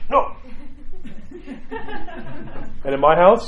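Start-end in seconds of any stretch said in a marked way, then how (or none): 0.1-0.4 put-on sulky child's "No"; imitating a defiant boy. Father mimicking his son refusing to apologise, sulky. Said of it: "He went like this". 0.4-2.8 laugh. Real, not acted. Laughing at his own impression.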